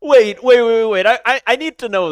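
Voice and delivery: loud, high wait wait; loud, high, urgent interrupting; urgent interruption. Real, not acted. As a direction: cut in eagerly. Interrupting the reading excitedly.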